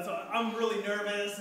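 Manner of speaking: normal talking voice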